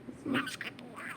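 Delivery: whispery little bird voice copying words; chirpy talking budgie; parakeet chatter. Real, not acted. Parakeet echoes owner.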